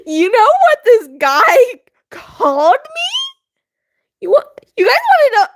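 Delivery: giggling through the question; can't get the words out for laughing; high, breathless giggly laugh. Real, not acted. Giggling while telling the story.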